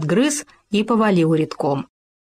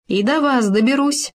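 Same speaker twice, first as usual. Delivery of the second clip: higher threatening fox voice; sly fox threat; 6 semitones higher than usual. A narrator's reading. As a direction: menace them with a sly grin. Fox threatens gopher and Khoma. (угроза лисы, голос выше)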